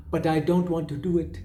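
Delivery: put-on a light Indian or Arabic accent, as the singer does it; imitating Indian accent. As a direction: mimic the singer's Indian-sounding accent on the line. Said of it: "saying it with an Indian accent or an Arabic accent".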